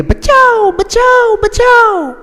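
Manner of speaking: high falsetto mock damsel screaming; heroine's cry for help. Teacher imitating a dangling heroine crying for help, high falsetto. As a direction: squeal it like a film heroine on a branch. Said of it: "वो चिल्ला रही है".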